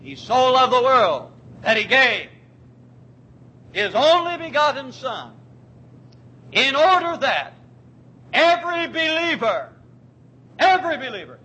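fervent sermon build; preaching. Acted. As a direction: build it up from the pulpit. Preaching at full force.

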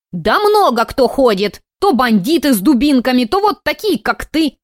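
put-on high, loud old lady rant; ranting old woman. A narrator's reading. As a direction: complain like a grumpy granny.

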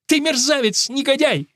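high-pitched outraged outburst; furious woman crying out; angry cry. A narrator's reading. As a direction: hurl the insult at him. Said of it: "выкрикнула мама".

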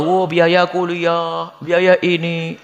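slow, drawn-out imitated grumble; drawling mock whine of complaint; mock complaining. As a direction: imitate someone moaning, stretching the words out. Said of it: "Kayaknya selalu ngeluh".

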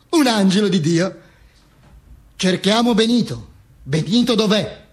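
ordinary delivery

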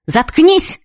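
high, rising sharp order; shout. A narrator's reading. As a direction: shut a rival up, pitch leaping. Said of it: "крикнула Юлька". (резкий окрик)

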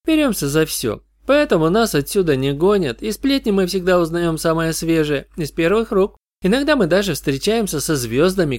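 put-on high, chatty young fan; breezy gossiping goth teen; goth girl voice. Goth girl chatting about fans.

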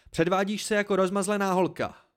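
high, loud scolding; words pour out hot with anger; heated outburst. A narrator's reading. Narrator scolds Dena as spoiled, heated. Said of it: "Slova se ze mě vylila jako roztavené železo".

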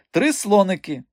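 high mocking mimicry; Madame Buchenbach. A narrator's reading. Man mimicking the directress to the strongman, mocking imitation. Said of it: "перекривив він мадам Бухенбах".

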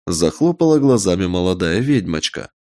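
ordinary delivery

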